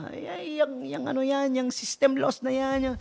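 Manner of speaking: high, soft, swooning mock gasp; gushing gullible voice. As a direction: play the easily impressed fan, breathy with awe. Mocking gullible easily swayed listener. (boses ng madaling mapaniwala, mataas at malambot)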